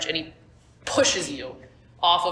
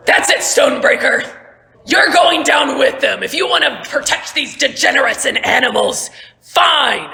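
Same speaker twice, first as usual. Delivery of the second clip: loud, higher furious threat; Merrick threatening; 6 semitones higher, 6 dB louder than usual. Acted. Merrick in the sea vowing revenge on Stonebreaker, furious shout. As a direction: roar it up from the water. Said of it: "and he goes".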